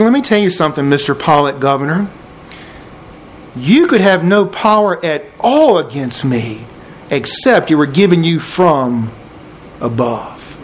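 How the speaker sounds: unhurried calm, letting the pitch settle down; calm Jesus. Acted. Jesus answers Mister Pilate the governor, very calmly. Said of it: "I think he said it so calmly".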